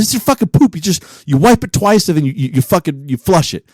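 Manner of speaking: high-pitched, exasperated mock rant; imitated outburst. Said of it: "what's going on with this outburst".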